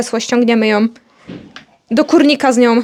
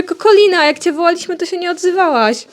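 higher-pitched mock telling-off; playful raised-pitch reproach; retold scolding; 6 semitones higher than usual. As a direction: reproach the pet hen, as retold. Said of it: "Ja mówię".

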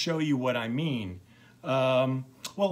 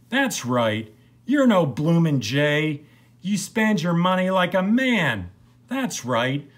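loud, higher flattering saloon woman; 7 semitones higher, 8 dB louder than usual. Acted. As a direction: egg the drunk on, loudly. Hailed a woman.

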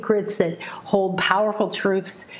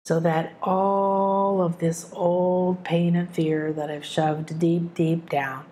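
prayer. Acted. Speaks a prayer aloud.

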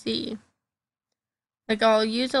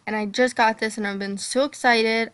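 usual speaking voice

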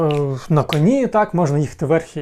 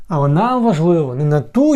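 ordinary talk

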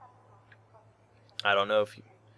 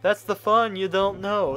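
higher, louder gleeful run; excited; 10 semitones higher, 7 dB louder, slower than usual. Real, not acted. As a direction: gush about the thrill. Gleeful aside while playing.

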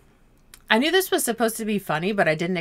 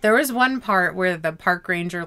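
ordinary talk, nothing marked

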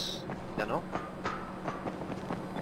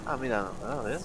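muttering; 3 semitones lower, 15 dB quieter than usual. Real, not acted. Quiet muttering while playing.

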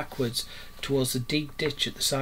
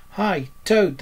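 raised, louder hail; calling out; 6 semitones higher, 6 dB louder than usual. A narrator's reading. Rat and Mole summon Toad to help, calling out. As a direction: call to a friend across the road. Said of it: "they cried".

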